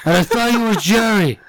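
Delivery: high, rambling stranger voice; a stranger explaining why he followed him; sheepish stranger. Retold or imitated. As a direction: excuse yourself awkwardly. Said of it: "He goes".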